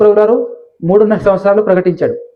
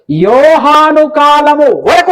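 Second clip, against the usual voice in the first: drawn-out, raised recitation; slow scripture reading; 5 semitones higher, 3 dB louder, much slower than usual. Shown as a demonstration. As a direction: stretch each word as if reading aloud to a class. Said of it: "అన్నాడు మళ్ళీ అక్కడ". (నెమ్మదిగా వచనం చదవడం)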